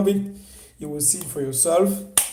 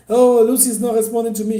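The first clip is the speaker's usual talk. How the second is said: mock whiny complaint; 7 semitones higher, 10 dB louder than usual. Mocking a complaining client.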